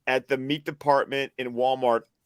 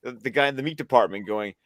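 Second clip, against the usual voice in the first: normal talking voice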